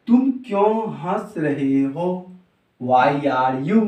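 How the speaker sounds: drawn-out, separated words; slow, measured recital. Shown as a demonstration. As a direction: go slowly word by word for learners. Spells out sentence with its translation. (धीरे-धीरे, हर शब्द अलग)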